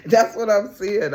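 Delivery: loud, slowed, laughing emphasis; excited laughing shout. Real, not acted. Reader telling viewers what the cards show, laughing and loud. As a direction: shout it through the giggles.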